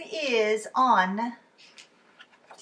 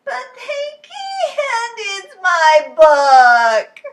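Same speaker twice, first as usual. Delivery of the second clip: very slow, loud, high sobbing wail; a child breaking into tears; crying Lola; 11 semitones higher, 16 dB louder, much slower than usual. Acted. Lola sobbing to Charlie, slow and loud.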